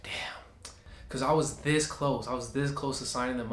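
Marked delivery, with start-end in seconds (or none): 0.0-0.7 breathy under-the-breath damn; whispered damn as the sale slips away; whispered curse. Retold or imitated. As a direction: mutter it to yourself, deflated. Groaning at losing the sale.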